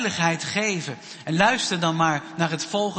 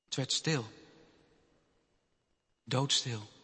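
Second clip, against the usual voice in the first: hushed narration; 6 semitones lower, 10 dB quieter than usual. A narrator's reading. Storyteller telling children the crowd fell silent, hushed. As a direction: drop to a near-whisper hush.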